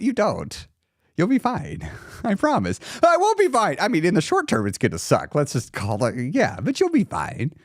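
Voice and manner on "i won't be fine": high, whiny contradiction; mock desperate protest. Emotional eater answering reassurance, mock desperate protest. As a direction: whine back way up high.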